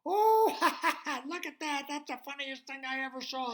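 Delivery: high, loud, hooting with laughter; laughing. Acted. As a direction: talk through uproarious laughter. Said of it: "she heard laughing".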